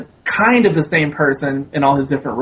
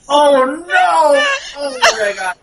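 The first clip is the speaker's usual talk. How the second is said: mock dismay; 12 semitones higher, slower than usual. Film fan recalling her reaction to the Godfather lookalikes, mock dismay. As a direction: cry out in comic, overwhelmed disbelief. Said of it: "And I was like".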